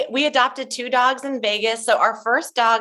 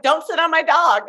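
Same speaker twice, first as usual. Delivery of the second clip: Debbie warning; 8 semitones higher than usual. Retold or imitated. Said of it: "she's like".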